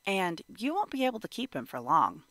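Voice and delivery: plain reading voice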